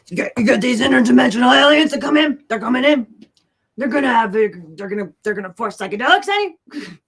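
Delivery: hammed-up panic about aliens coming in; mock conspiracy rant. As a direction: rant like a frantic radio conspiracy host. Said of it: "I need to work on it".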